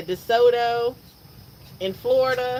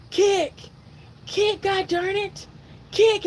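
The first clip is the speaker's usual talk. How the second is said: husband yelling from the water for his wife to kick, urgent high yell; 10 semitones higher than usual. Retold or imitated. Husband urges his wife to kick, high urgent yelling. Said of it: "So he's like".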